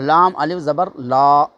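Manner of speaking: slow, with لا drawn out long; long la spelled out. Shown as a demonstration. Teacher contrasting the long لا with the short one, slowly. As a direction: spell out لام الف زبر لا slowly and stretch the لا. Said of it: "اس کا فرق ہٹا دیتے ہیں".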